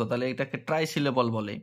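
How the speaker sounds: ordinary delivery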